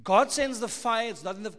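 usual speaking voice

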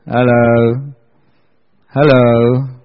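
low, drawn-out hello to a silent room; prodding the crowd. Real, not acted. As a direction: coax a reply out of them, low and teasing. Preacher prods a quiet crowd.

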